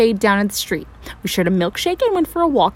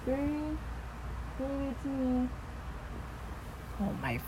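hushed; 3 semitones higher, 18 dB quieter than usual. Acted. Clone at door again.

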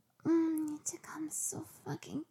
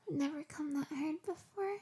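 ordinary delivery

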